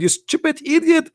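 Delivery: imitated angry rant. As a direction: scold your own side in frustration. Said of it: "he screamed at them".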